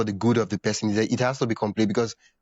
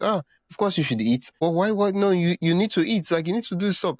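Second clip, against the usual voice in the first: higher, insistent imitation of a nagging carer; mock pushy carer; 7 semitones higher than usual. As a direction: ham up someone pressing food on you. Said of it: "You're like".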